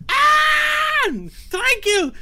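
very high, loud startled shriek of a name; shocked cry. Acted. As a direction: shriek her name in alarm. Koba cries out seeing Tranquil.

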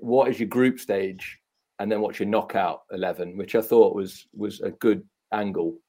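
usual speaking voice

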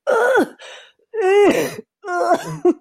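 high, loud mock choking grunts; comically pained high grunts; imitated strained grunts. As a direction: imitate someone straining in a chokehold, refusing to tap. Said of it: "making the funniest noises".

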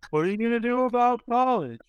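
high, mocking teen voice; mock high schooler. Students quizzing the candidate, mocking high teen voice. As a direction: ham up a clueless student's question. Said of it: "all the standard high school questions".

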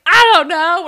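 mock panicked wail; panicked reply. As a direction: wail it helplessly. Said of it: "And Drew's like".

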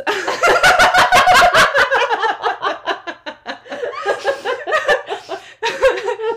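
loud laugh. Real, not acted. Big laugh at own joke.

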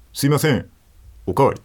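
loud call to a waiter. Acted. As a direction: call out for a refill. Calling for a refill.

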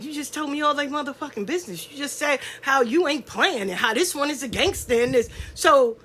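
ordinary talk, nothing marked

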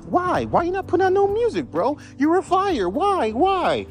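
very high-pitched mock whine; imitated pleading fan. Fan begging a rapper who quit for new music, high mock pleading.

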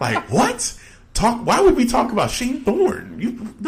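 can't believe what he just heard; incredulous disbelief. Real, not acted. Podcast host reacting to a rival's absurd claim, incredulous high-pitched disbelief. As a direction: pitch shoots way up in outraged disbelief.

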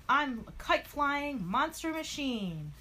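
high, slow hero proclamation; triumphant announcement. Acted. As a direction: proclaim the new form, stretching the words. Hero announces his transformation.

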